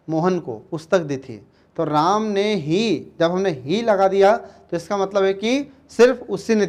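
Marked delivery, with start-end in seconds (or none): 1.8-3.1 slow Ram ने ही with ही drawn out and falling; teacher repeating the example with emphasis on ही; stress on ही. Shown as a demonstration. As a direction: drag out ही for emphasis. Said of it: "जोर देकर बात कर रहे हैं".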